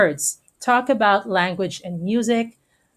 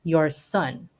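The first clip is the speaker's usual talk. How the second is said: spelled out slowly and plainly; slow paraphrase; 2 semitones lower, slower than usual. Shown as a demonstration. Teacher paraphrasing the old line for students, slow and plain. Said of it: "It's just".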